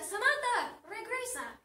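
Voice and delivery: high, loud call after someone; urgent holler, easing on the last word; acted shout. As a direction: yell as a dad chasing a car. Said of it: "El padre corre y corre y grita".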